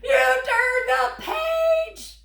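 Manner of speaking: high, loud, slow wail of dismay; alarmed cry. Acted. Grover wails at the child who turned the page. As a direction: cry out in mock horror.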